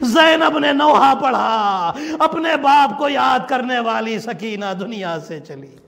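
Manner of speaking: drawn-out wail sinking at phrase ends; keen it slowly, letting it fall away; closing lament. Acted. Preacher announcing Sakina's death to mourners, slow falling lament.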